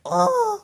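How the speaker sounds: sudden high yelp from a gut punch; a sharp, surprised cry of pain; pained grunt. Retold or imitated. Punched in the stomach by the driver, imitated hurt yelp. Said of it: "I make this grunting sound like".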